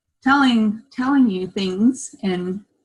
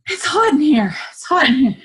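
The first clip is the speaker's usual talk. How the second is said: retold complaint; 7 semitones higher, 3 dB louder than usual. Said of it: "I was like".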